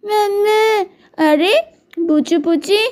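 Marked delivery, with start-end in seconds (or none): 0.0-0.6 put-on higher, whimpering little child calling mamma; a small sick child crying for its mother. Little one crying to its mother, whimpering.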